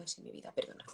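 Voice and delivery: meek, hushed apology to her son; sheepish whisper. Retold or imitated. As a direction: back down in a whisper. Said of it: "y yo bajo el tono".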